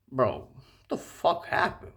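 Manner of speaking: slow, bewildered mutter; puzzled and drawn out. Retold or imitated. Guy wondering on the ride home, slow and bewildered. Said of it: "I was like".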